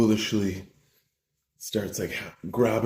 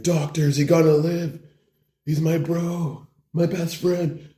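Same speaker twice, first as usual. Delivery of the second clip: high, slowing tearful-style plea; hammed-up heartfelt worry; melodramatic pleading; 7 semitones higher than usual. Bro asks doctor about his friend, pleading dramatically.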